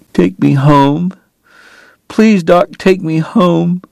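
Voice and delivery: high, slow plaintive plea; pleading. A narrator's reading. Homesick woman begging Doc, pleading. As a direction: beg him, drawing it out high.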